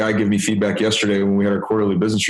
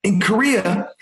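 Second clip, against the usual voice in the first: much higher voice, insistent; imitating a colleague; 11 semitones higher, slower than usual. Colleague explaining Korean office custom to boss, high mock voice. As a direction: set him straight in a high mock voice. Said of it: "He's like".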